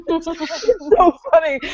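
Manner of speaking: high, slow, laughing it out; can barely get the words out for laughing; giggling through it. Real, not acted. Laughing at the invisible Bigfoot.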